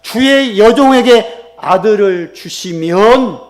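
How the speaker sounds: loud, high, slow prayer cry; emphatic vow. Acted. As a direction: proclaim it to God, stretching each phrase. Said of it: "그러면서 한마디를 딱 합니다".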